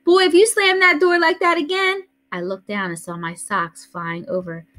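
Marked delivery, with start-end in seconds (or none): 0.0-2.0 high, loud grandmother holler; yelling. A narrator's reading. Grandmother yells after the boy running out the door. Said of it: "Grandma Sands yelling".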